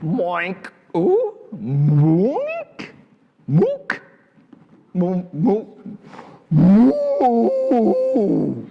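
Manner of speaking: muddled moinks building to a high, loud moo; cow practising moo. A narrator's reading. Storyteller as cow that tried and tried, struggling then triumphant mooing. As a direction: fumble toward a moo until it rings out. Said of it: "tried and tried again".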